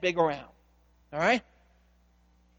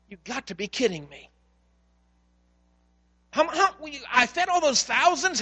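higher, pleading disbelief; Jesus shaking his disciples awake; Jesus scolding his disciples in exasperation; exasperated Jesus; 6 semitones higher than usual. Acted. Jesus rebuking disciples, voiced.

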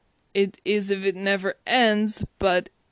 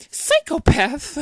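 slow, higher incredulous echo; offended disbelief; 6 semitones higher, 5 dB louder, slower than usual. Retold or imitated. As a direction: repeat the word as if stung. She repeats the insult, offended.

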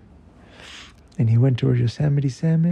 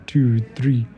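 ordinary delivery

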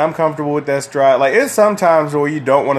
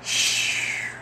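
shush; 9 dB quieter than usual. Real, not acted. Shushing before recording.

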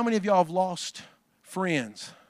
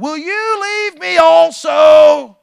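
slow, high, loud pleading cry; Jesus pleading; 9 semitones higher, 12 dB louder, much slower than usual. Acted. Jesus calls after his departing disciples, slow loud plea. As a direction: call out to them, slowly, as if they're walking away.

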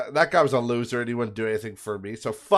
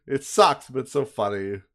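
ordinary talk

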